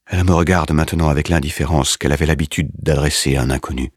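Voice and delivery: ordinary delivery, nothing marked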